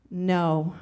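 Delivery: low, deadpan delivery; dead serious. Retold or imitated. Aunt breaking news outside surgery, dead serious. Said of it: "dead serious".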